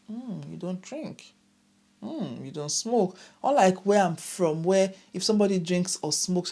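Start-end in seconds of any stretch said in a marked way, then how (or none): 0.1-3.2 slow, hushed, quizzical "Hmm, you don't drink"; mock surprise. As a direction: imitate locals eyeing a non-drinker sideways. Mocking how locals react.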